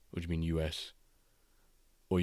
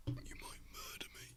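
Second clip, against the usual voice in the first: whispered mock dread; 17 dB quieter than usual. Whispered repeat.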